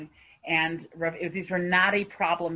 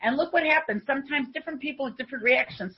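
higher and louder, rousing sermon voice; rising fervent sermon; fervent preaching; 8 semitones higher, 8 dB louder than usual. Acted. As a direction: preach it to the congregation with fire. Preacher drives home the lesson.

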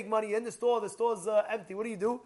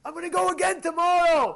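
higher, louder pat-yourself-on-the-back cheer; self-congratulation; 6 semitones higher, 5 dB louder than usual. Acted. Learner giving himself a tap on the back, self-congratulating. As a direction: cheer yourself on, pitched up and punchy. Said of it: "a tap on the back".

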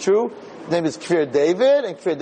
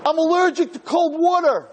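put-on much higher, small boy's voice; 13 semitones higher than usual. Swollen student answering his rabbi in a high boy's voice. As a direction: voice the scared boy high.

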